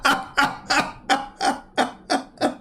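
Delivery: high breathy giggling; real laughter. Real, not acted. Streamer reacting to the played TV clip, giggling. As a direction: giggle in quick, helpless pulses.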